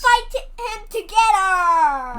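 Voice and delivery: loud, high rallying line; loud hero cry. Pretend. Child voicing Turtle Man rallying Volcano Man, loud and high. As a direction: shout it like a hero calling his ally. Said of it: "he goes".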